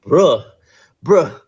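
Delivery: playfully imitating someone at their wits' end; mock exasperation. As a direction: plead like fed-up hotel staff, pausing after each bruh. Voicing exasperated hotel staff pleading.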